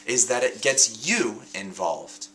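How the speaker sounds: usual speaking voice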